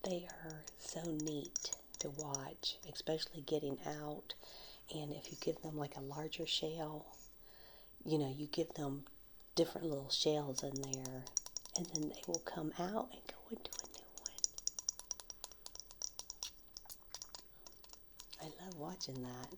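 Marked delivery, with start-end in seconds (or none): 0.0-5.8 whisper with nail taps. Real, not acted. ASMR whisper continues. 8.4-19.5 whisper with nail taps. Real, not acted. ASMR whisper continues.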